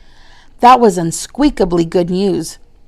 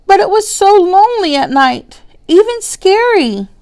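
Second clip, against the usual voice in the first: high, small squeaky hamster voice; 11 semitones higher, 4 dB louder than usual. Acted. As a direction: squeak it up, tiny and earnest. Hamster voice speaking up.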